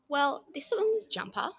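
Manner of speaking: slow, proud introduction. A narrator's reading. Missus Grey proudly introducing her son to Big Red. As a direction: present him with pride. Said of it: "she said proudly".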